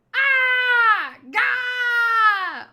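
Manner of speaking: high, loud terrified screams; henchmen screams. Acted. Henchmen yelling while their king chomps on them. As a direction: shriek as if being eaten.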